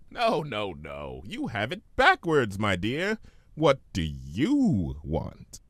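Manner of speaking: slow, stressed YOU, teasing genie; Discord. Acted. Discord correcting Fluttershy, stress on you. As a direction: lean hard on you, drawn out.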